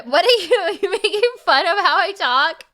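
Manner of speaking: amused, laughing complaint about being mocked; laughing accusation. Real, not acted. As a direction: accuse them while cracking up.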